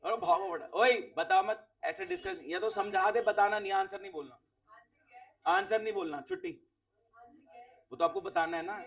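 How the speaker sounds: normal talking voice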